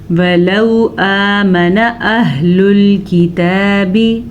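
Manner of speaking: slow melodic tajweed chanting; Quran recitation. Acted. Teacher reciting the verse slowly for students, melodic chant. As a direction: draw each verse out in measured recitation.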